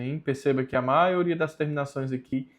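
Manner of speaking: usual speaking voice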